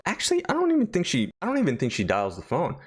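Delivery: normal talking voice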